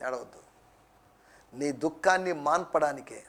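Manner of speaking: very soft, low reassurance; gentle consoling. Acted. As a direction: comfort the mourner quietly. Voices Jesus comforting the mourner.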